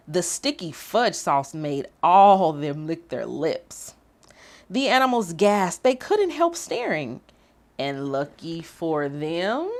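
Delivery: lively, sing-song storybook reading; reading to children. A narrator's reading. Host reading picture book to young viewers, lively storytime voice. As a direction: read it like story time for little ones.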